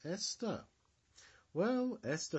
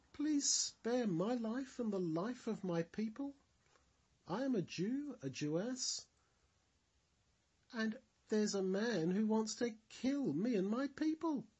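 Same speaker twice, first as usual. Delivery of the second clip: higher, trembling plea for her life; nervous pleading; 9 semitones higher than usual. Acted. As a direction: beg the king nervously, voice raised. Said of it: "in a very nervous voice".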